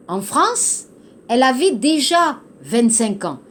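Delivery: ordinary talk, nothing marked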